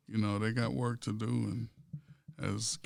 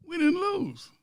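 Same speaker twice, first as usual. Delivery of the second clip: much higher squeaky quote; high-pitched mock outrage; mock incredulous fans; 3 dB louder, slower than usual. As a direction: squeal it in disbelief. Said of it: "Kansas City fans are saying".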